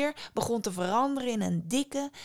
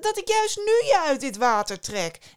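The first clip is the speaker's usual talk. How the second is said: higher, louder fisherman protesting; indignant fisherman, pitched up; 7 semitones higher, 6 dB louder than usual. A narrator's reading. Fisherman complains. (hoge, verontwaardigde vissersstem)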